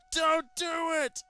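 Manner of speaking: high, loud, slow desperate yell. Acted. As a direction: yell to stop the knife. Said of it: "I yell".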